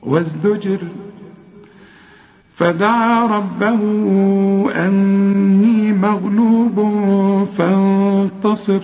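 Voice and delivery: slow chanted tajweed recitation; Quran recitation. Acted. Teacher leading the class in reciting the verses, slow chanted tajweed. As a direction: chant each verse slowly, holding the long vowels.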